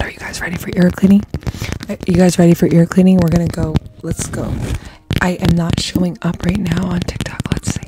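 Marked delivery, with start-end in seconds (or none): 0.0-1.2 fast ASMR whisper. Real, not acted. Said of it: "same question, whispered take". 5.2-7.8 whispering. Real, not acted. ASMR live, whispered chat.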